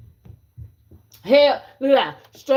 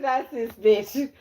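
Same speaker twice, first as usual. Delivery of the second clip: ordinary talk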